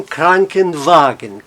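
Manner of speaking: slow, KRAN-ken-wagen with the first syllable leaning heaviest; stress on Kranken. Shown as a demonstration. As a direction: say Krankenwagen slowly, hitting KRAN. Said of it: "eu vou dar um exemplo da palavra". (tonicidade em KRAN de Krankenwagen, bem devagar)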